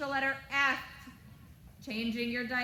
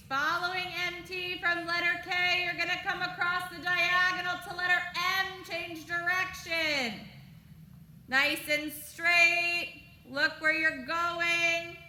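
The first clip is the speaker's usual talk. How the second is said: higher, louder coaching call across the ring; projected arena commands; 7 semitones higher, 5 dB louder than usual. Acted.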